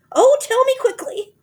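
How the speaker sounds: very high, very loud terror; panicked old wife; high loud terrified cry; frightened cry. A narrator's reading. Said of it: "she cried".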